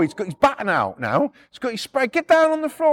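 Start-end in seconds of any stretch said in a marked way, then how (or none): 2.1-2.9 high-pitched mock shout; shouted police order. Copper with baton out ordering repo man down, shouting. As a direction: bark the order, much higher. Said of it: "They're all fucking shouting at us".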